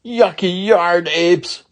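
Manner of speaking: high, loud, slow drawn-out insult; slow angry yell. Acted. Ramona yells at the boys, slow and furious. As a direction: spit out each word slowly. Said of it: "she yelled again".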